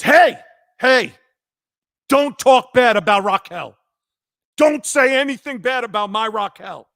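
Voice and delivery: father scolding son over his idol; defensive dad. Retold or imitated. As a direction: snap at son to defend her.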